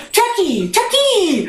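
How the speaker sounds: high teasing Chucky, rising on the second; mocking name call. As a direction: tease her with a mangled name. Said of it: "he would work in some weird way".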